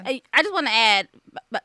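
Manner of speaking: real stammering, repeating word starts in eagerness; excited stutter. Real, not acted. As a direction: stumble over the first words from excitement. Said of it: "I'm stuttering 'cause I'm just so excited".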